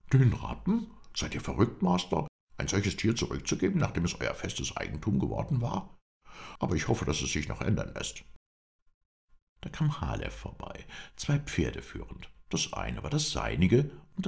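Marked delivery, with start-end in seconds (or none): 0.1-8.2 high, incredulous outburst that settles; astonished Englishman. A narrator's reading. Englishman hears the horse was given back, astonished. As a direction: exclaim at such madness, voice jumping up.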